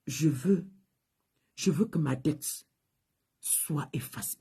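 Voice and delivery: slow, hushed plea fading to a whisper; a woman breathing out her deepest wish. Retold or imitated. Indebted woman whispers her wish to the pastor, hushed and slow.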